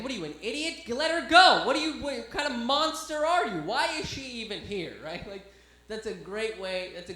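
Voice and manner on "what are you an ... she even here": high, loud comic outburst; outraged judge. Retold or imitated. Imagined judge berates cops for holding her, high shouted indignation. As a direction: scold the police in mock outrage. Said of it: "the judge is gonna be like".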